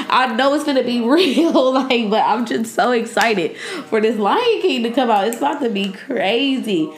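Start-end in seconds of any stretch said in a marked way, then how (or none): none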